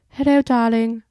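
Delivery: British greeting. Retold or imitated. British actor answering his girlfriend's call, hammed-up British accent. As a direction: belt it out as a hammed-up British man. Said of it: "and he goes".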